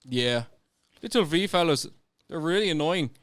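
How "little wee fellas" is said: put-on German accent. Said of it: "That's a German accent".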